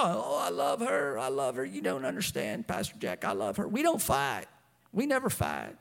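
low, smitten fiancé impression; low-voiced mock gushing over his girl; lovestruck fiancé. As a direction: play a besotted young man pleading with his pastor. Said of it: "they start talking about the, the girl".

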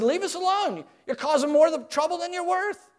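high, scornful whine; mock the leader with a sneering whine; sarcastic. Israelites telling Moses off, sarcastic high whine. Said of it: "Notice the sarcasm".

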